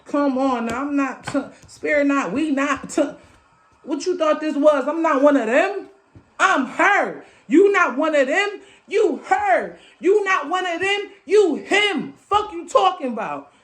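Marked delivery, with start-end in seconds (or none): 3.8-13.5 high, punchy call-out; hyped boasting. Real, not acted. Hyping listeners that they stand apart, boastful and fired up. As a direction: challenge them, each short line pitched up.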